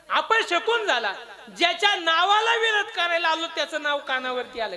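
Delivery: high, loud lament; dismayed outcry. Acted. Demon king hears his enemy's name, dismayed outcry. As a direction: proclaim the bad omen loudly.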